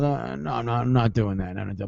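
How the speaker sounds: fading refusal. Retold or imitated. Refusing the dare, fading out to a murmur.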